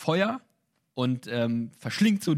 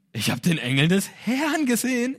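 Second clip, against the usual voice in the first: higher and louder panicked exclamation; freaking out; 7 semitones higher, 4 dB louder than usual. Retold or imitated. Gideon stunned at seeing the angel, panicked exclaim. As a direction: blurt it out in shock. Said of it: "er rastet aus".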